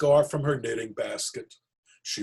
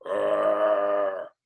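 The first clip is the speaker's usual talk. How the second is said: bear growl; 2 semitones lower, 4 dB louder than usual. Acted. Bear growls at the rabbi's wife.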